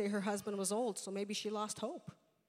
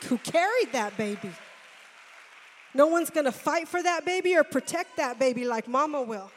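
loud, higher preaching build; preaching at full force; 4 semitones higher, 12 dB louder than usual. Acted. Preacher praises mothers to congregation, loud preaching. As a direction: drive it home to the congregation.

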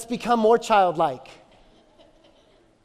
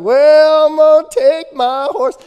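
put-on playful kid voice; 6 semitones higher, 10 dB louder, slower than usual. Son voiced making his entrance.

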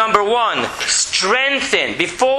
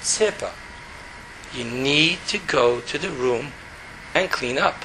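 much lower, softer, calm and even; tone brought down; 6 semitones lower, 7 dB quieter than usual. Shown as a demonstration. Father telling his daughter to clean her room, low and calm. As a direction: keep it low and steady, no yelling. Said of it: "bring your tone really down".

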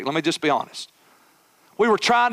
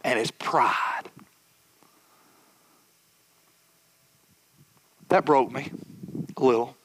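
quiet confession; 6 semitones lower, 4 dB quieter than usual. Real, not acted. Preacher to congregation, a quiet broken confession. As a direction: let the words sink, softly broken.

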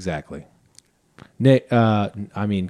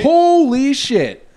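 slow, high drawn-out shock; disgusted surprise; 10 semitones higher, 3 dB louder, much slower than usual. Real, not acted. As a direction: recoil from the smell. Smelling the egg beer.

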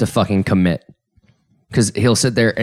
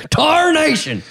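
high cartoon cowboy yelp; Yosemite Sam; slower than usual. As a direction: squeal it like a grumpy cartoon cowboy. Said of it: "sort of sounds like Yosemite Sam".

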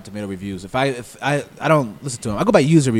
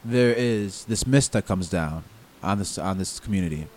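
trailer voice; 2 semitones lower, 4 dB quieter than usual. Acted. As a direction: narrate it like a horror movie trailer. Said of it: "Do I have to match this trailer voice?".

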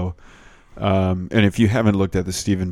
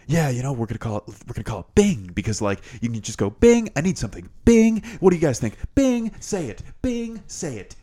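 hyped-up CEO voice climbing higher on each Bing; pushy boss pitching a name to the room; Ballmer impression; 7 semitones higher than usual. CEO pitching the name Bing to his staff, hyped and pushy. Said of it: "this guy does a much better impression of Ballmer".

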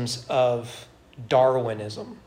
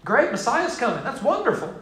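sarcastic Israelites; 7 semitones higher than usual. Retold or imitated. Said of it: "they're thinking".

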